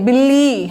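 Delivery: "বিলি" with the final ee pulled long; drawn-out syllable. Shown as a demonstration. Teacher showing learners where to drag, long "লি" in "বিলি". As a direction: stretch out the "লি" of "বিলি". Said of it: "এভাবে টান দিবেন".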